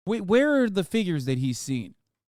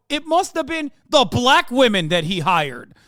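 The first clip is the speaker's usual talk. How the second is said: mocking quote; 6 semitones higher, 6 dB louder than usual. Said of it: "look at that and go".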